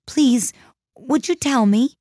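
high, tentative polite request; a little timid. A narrator's reading. Alice speaks first to the Duchess, a little timidly. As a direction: ask politely in a high girlish register. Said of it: "said Alice a little timidly".

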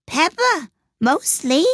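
high-pitched character voice; curt cook. A narrator's reading. Cook answering the King's question, curt high voice.

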